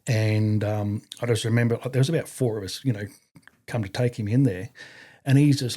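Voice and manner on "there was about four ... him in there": normal talking voice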